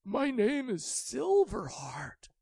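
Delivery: high, loud urgent call; eager dragon voice. A narrator's reading. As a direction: cut in eagerly. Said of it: "But the dragon said".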